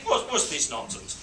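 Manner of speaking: ordinary delivery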